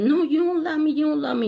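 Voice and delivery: lightly voiced upset son; son crying.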